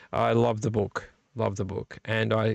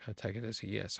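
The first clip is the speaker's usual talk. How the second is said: very quiet; 5 semitones lower, 16 dB quieter, faster than usual. Real, not acted. Quiet question to Mark.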